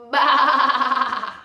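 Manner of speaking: a gasp, then a loud laughing baa; loud sheep baa. Shown as a demonstration. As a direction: bleat it out like a playful lamb. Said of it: "Let's hear what it sounds like".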